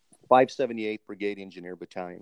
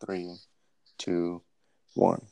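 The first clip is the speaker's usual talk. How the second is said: usual speaking voice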